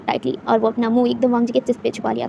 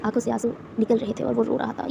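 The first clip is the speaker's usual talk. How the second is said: ordinary delivery, nothing marked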